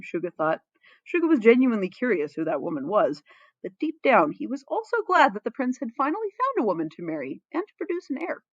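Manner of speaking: ordinary delivery, nothing marked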